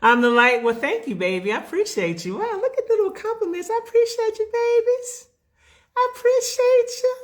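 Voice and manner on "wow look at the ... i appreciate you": very high, playful little voice; gushing squeaky voice. Pretend. Thanking viewers for their compliments, squeaky gushing voice.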